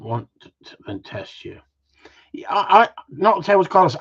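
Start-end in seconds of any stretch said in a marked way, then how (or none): 2.3-3.9 raised, higher voice to stop the dog; sharp correction aimed at a dog; telling off the dog. Real, not acted. Host scolds Carlos for knocking the tables, raised voice. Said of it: "telling dog off loudly".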